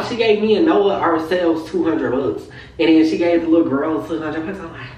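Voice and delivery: ordinary delivery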